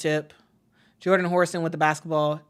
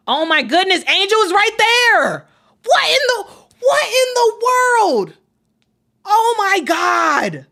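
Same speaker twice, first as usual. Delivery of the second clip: real disbelief, much higher and louder, yelling at the screen; slow stretched cry of what in the world; heated shouting; 13 semitones higher, 6 dB louder than usual. Real, not acted. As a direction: shout it out in stunned outrage. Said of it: "I got a little bit heated".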